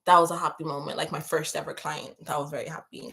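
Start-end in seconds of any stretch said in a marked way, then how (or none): none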